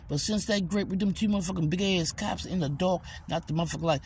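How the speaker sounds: ordinary delivery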